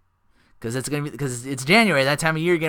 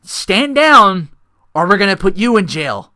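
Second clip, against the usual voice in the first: loud, raised command; stern cop order; 5 semitones higher, 10 dB louder than usual. Retold or imitated. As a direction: bark it like a cop. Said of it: "And she's like".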